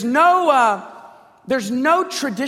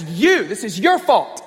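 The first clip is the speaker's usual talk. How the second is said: pointed mild accusation; acted blame. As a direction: pin the fault on the listener. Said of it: "When he begins this, he doesn't".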